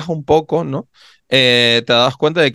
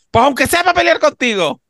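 put-on high, called-out old woman's retort; imitating grandma telling her husband off; grandmother's reply; 14 semitones higher than usual. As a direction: call it back teasingly, pitched way up.

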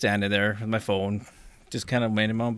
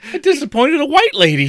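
mock guilt; 8 dB louder than usual.

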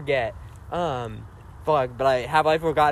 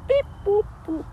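high robot beeps. Retold or imitated. Robotic small talk.

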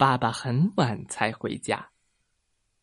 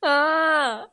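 high held wail; mock wailing cry; 11 semitones higher than usual. A narrator's reading. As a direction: cry out in a high, story-acted bawl. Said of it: "我放声大哭".

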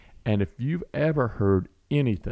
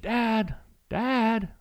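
put-on much higher little-girl call down the hall; a small girl calling her dad back from bed; child calling out; 14 semitones higher than usual.

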